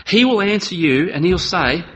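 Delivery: usual speaking voice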